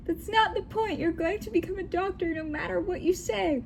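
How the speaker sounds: high-pitched mock scream at no extra volume; mock screaming dad. Dad overrules his daughter in a high mock scream. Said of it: "my dad screamed".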